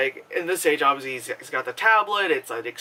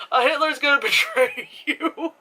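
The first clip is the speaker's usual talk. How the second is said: laughing warning; 10 semitones higher than usual. Retold or imitated. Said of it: "I was about to scream out".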